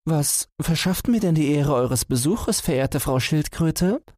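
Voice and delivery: high, polite hare voice. Acted. Hare greets the tortoise.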